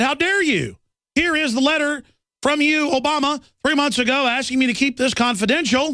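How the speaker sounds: high-pitched indignant outburst, mocking Dodd's anger; indignant senator. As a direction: pitch it way up in outraged disbelief. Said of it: "Dodd got actually mad".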